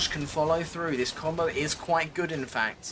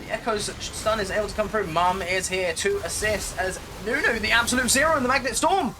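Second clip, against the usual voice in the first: excited; 5 semitones higher, faster than usual. Real, not acted. Teamfight erupts in live casting.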